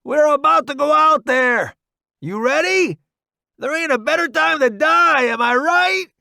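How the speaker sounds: loud, high battle hype; gung-ho yeehaw. A narrator's reading. Roddy hyping newbie before they charge out, gung-ho yeehaw. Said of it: "He practically yeehaws".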